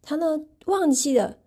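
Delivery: ordinary delivery, nothing marked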